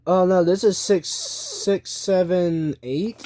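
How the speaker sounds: usual speaking voice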